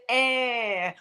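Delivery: drawn-out slow greeting; slow, casual "how you doing"; lazy pickup line. Retold or imitated. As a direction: drag it out like a cheesy pickup line. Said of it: "you have people who say".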